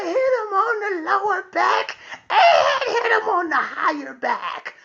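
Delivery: high-pitched mock child shout; frenzied egging on. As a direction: yell it high like a child cheering on a fight. Daughter eggs father on, acted out.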